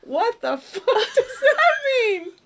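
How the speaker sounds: high, loud, drawn-out imitation of indignation; mock outrage. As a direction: cry out in hammed-up exasperation, half laughing. Actress's outraged reply, acted out.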